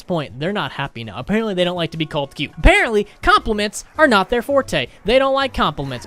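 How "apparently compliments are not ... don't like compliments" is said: higher, louder sarcastic jab; mock the bullies with a raised sneer. Real, not acted. Mocking the bullies who hate being called cute, sarcastic and raised.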